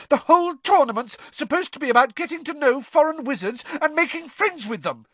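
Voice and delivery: very high, indignant; strident, pitched right up; shrill. A narrator's reading. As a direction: argue shrilly. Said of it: "said Hermione shrilly".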